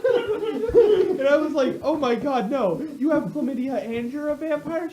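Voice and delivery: high, loud, laughing through the words; laughing mock horror; laughing disbelief. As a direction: crack up while acting horrified. Shocked reaction, laughing.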